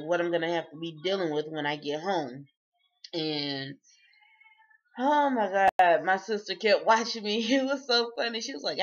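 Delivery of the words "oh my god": ordinary talk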